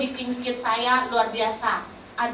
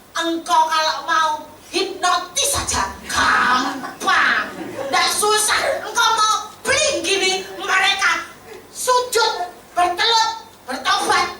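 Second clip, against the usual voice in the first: bold excited prayer; 7 semitones higher, 5 dB louder, slower than usual. Retold or imitated.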